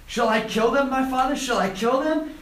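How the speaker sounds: mock-eager king bursting to get permission; overexcited. King of Israel asking Elisha, overexcited. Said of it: "a little bit too excited".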